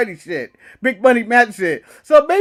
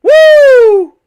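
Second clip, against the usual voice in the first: high, loud cheer; excited whoop; 12 semitones higher, 9 dB louder than usual. Real, not acted. Cheering the dive for his co-host. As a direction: whoop it out like a fan.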